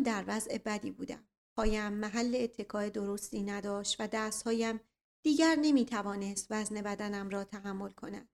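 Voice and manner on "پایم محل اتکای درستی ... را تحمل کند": ordinary delivery, nothing marked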